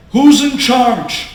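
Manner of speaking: loud, high, drawn-out question; emphatic challenge. Retold or imitated. Husband to his crying wife on the step, forceful. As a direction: demand it slowly and forcefully.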